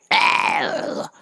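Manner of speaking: ordinary delivery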